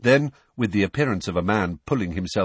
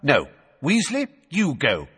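high, abrupt command; barked order; 7 semitones higher, 3 dB louder than usual. A narrator's reading. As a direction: order an underling off at once. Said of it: "Fudge barked at Percy".

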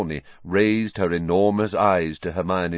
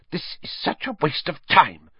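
hissed; 8 semitones higher than usual. Acted. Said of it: "Hermione hissed".

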